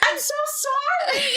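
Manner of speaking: hammed-up crying; mock tearful sorry. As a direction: whimper it like a crying kid. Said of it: "And Hillary's like".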